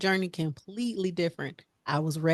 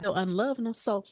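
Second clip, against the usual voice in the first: ordinary talk, nothing marked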